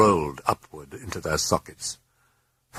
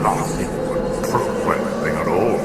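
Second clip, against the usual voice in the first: demon voice; 3 dB louder than usual. A narrator's reading. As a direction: draw the words out like something inhuman. Demon voice held.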